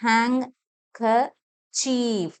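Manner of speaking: ഹാങ്, ക, ചീഫ് with clear pauses; syllable by syllable. Shown as a demonstration. Teacher breaks the word down, syllable by syllable. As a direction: split it up, long EE in ചീഫ്. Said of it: "long ആയിട്ടാ പറയണത്".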